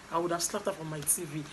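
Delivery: ordinary talk, nothing marked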